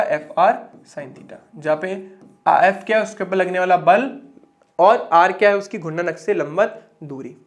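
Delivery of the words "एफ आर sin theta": एफ आर sin theta said slowly, word by word; slow dictation. Shown as a demonstration. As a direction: spell out एफ आर sin theta slowly so they can write it. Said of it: "क्या आएगा आपका". (धीरे-धीरे लिखवाते हुए)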